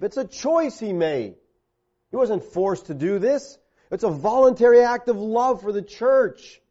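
forceful preaching. Real, not acted. Preacher tells husbands Christ chose freely, forceful.

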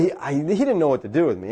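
ordinary delivery

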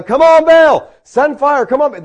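high, loud pleading shouts; acted yelling. Prophets of Baal carrying on to their god, mock yelling. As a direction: yell up to a god who won't answer. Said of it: "started yelling and screaming".

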